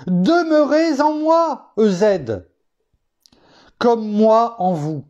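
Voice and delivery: slow, high and drawn-out, each word weighed; preacher voicing Christ's command, high and slow; solemn command. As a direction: proclaim the Lord's words slowly from on high. Quoting the verse again.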